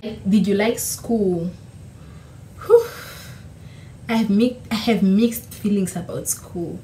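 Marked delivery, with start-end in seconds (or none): none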